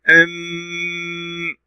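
أم with the m hummed very long on a level note; shadda held. Shown as a demonstration. Teacher sounding the shadda first for pupils, long held m. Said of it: "ننطق الشدة أولًا كما تعودنا".

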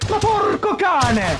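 high, drawn-out furious curse; explosive italian cursing. Real, not acted. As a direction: let the swear burst out slowly. Cursing after game mishap.

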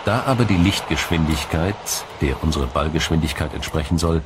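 ordinary delivery

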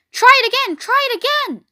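very high, urging cry; excited cry. A narrator's reading. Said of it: "cried the goose".

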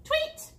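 very high chirped tweet; piping birdcall imitation; bird tweet. Narrator imitates a bird call.

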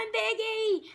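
usual speaking voice

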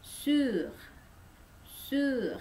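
"Sur" high with rounded u; French sur. Shown as a demonstration. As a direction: model for practice. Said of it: "Coba kalian latih di rumah".

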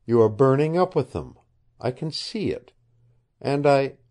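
a hint of an earnest girl pleading; young woman. Narrator voices the young woman.

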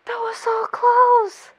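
high, slow, teary exclamation; overwhelmed squeal. Real, not acted. Viewer repeating over the near miss, high overwhelmed cry. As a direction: stretch CLOSE out high, nearly crying.

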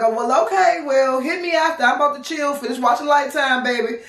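high, light sing-song brush-off; airy high voice with a small laugh; breezy fake cheer. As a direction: act like you don't care. Fake-breezy goodbye to the boyfriend.

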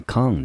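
con with a clear open "ah" vowel; full vowel con. Shown as a demonstration. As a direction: say con as stressed "kahn". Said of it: "我们念成con的音".

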